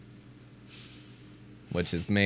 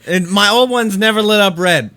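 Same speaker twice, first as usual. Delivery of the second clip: loud, high, drawn-out protest; worked-up, high-pitched insistence; indignant defence; 14 semitones higher, 13 dB louder than usual. Real, not acted. Indignantly defends his new vape.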